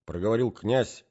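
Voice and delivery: ordinary delivery, nothing marked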